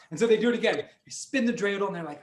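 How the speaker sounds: ordinary delivery, nothing marked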